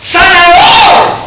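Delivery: slow, loud, rising call. Acted. Preacher mocking a plain call to Jesus, loud. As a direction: call him by his title. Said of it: "le dijo".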